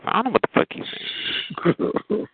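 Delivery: high, slow, breathy mock whisper; a whispered, drawn-out put-down. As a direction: breathe it out slowly, mocking. Mocking caller she can't understand.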